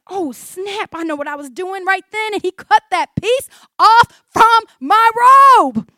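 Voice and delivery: startled Saul piecing it together; dawning realization. Acted. Voices Saul's realization about the robe.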